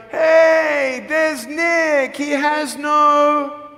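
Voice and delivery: high, loud mocking shout of a bully; bully's taunt. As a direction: yell it across the hall to humiliate. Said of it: "he's like".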